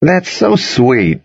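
high, drawn-out, slow teasing; teasing call. A narrator's reading. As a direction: call it across the room, stretched out. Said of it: "I called".

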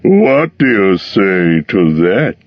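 dragon showing off; proud dragon. A narrator's reading. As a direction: call the hobbit to admire. Dragon shows his belly.

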